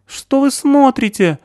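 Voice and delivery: high, loud hysterical accusations; panicked screaming; hysterical shouting. A narrator's reading. Stasik shrieks at crowd.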